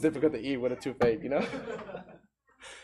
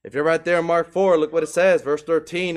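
ordinary delivery

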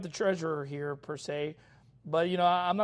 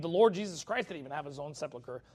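ordinary delivery

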